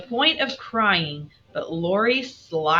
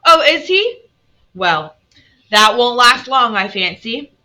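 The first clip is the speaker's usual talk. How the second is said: put-on loud, raised-pitch dismissive old lady; disdainful old aunt; 5 semitones higher, 7 dB louder than usual. A narrator's reading. Aunt scoffs at news of father, loud and dismissive. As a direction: pronounce it dismissively, high and loud.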